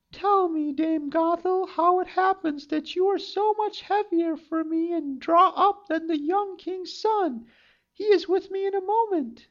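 put-on high girlish storybook voice; high young maiden voice; Rapunzel's voice. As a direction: think it as a hopeful young girl. Rapunzel speaks to enchantress.

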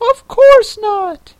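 very high, loud squeak; Mock Turtle. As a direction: dismiss it slowly in a piercingly high voice.

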